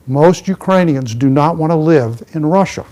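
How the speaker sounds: rising loud declaration on "Russia". Real, not acted. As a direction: proclaim it to the whole room. Speaker drives home the quote.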